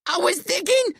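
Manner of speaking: very high, strained gasp; gasped, panicked. A narrator's reading. Billy, clinging to his friend, starts explaining, gasping. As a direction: gasp it out in high-pitched fright. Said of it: "Billy gasps".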